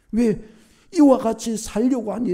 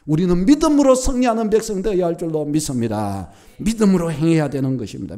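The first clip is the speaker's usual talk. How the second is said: louder ringing sermon declaration; emphatic preaching; 6 dB louder than usual. Acted. Pastor declaring victory by faith, emphatic preaching. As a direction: proclaim it like a firm creed.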